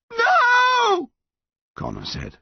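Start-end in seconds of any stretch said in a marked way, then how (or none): 0.1-1.1 long, high, anguished refusal; voice breaking. A narrator's reading. Son refusing the yew tree's demand, voice breaking. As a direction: cry out as your voice breaks. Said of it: "his voice breaking".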